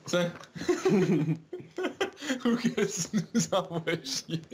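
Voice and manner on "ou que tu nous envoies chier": slow, laughing repeat; laughing mockery. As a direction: echo it through laughter. Repeats friend's line laughing.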